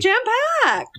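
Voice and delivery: high squeaky echo of the word; high playful echo. Acted. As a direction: chirp it up high and bright. Echoing the phrase playfully.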